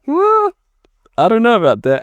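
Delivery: high, loud startled disbelief; incredulous surprise. Retold or imitated. Teen brushing off teacher's documentary idea, startled and incredulous. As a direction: yelp it out like the idea is absurd. Retold reply to teacher's suggestion.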